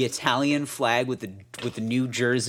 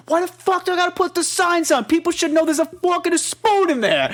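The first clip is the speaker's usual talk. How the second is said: much higher hammed-up angry dad; angry Jersey dad; 3 dB louder than usual. Mimicking the dad arguing with mom, much higher mock voice. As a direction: squeal the dad's rant in a mock voice. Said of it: "He goes".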